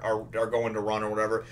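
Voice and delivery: usual speaking voice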